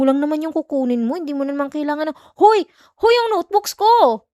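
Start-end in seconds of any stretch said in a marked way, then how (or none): 2.4-4.3 loud, rising yells to stop someone running off; shouting after him. Acted. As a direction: call him back at full volume, high and sharp. Yelling as he runs off with notebooks. (sumisigaw para pigilan siya sa pag-alis)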